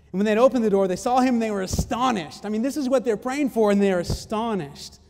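preacher building up in astonishment; emphatic preaching. Real, not acted. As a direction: drive the amazement home to the congregation. Preaches at full force.

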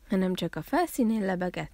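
plain reading voice

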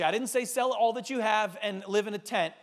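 ordinary delivery

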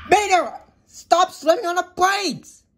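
high, exasperated shout; high-pitched furious scolding; angry yell. Acted. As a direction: yell at the learner after a jolt. Yelling at learner after hard braking.

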